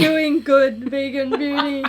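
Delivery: slow, tearful laughing voice; crying with laughter. Real, not acted. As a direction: squeeze it out while laughing so hard. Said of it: "I'm actually crying".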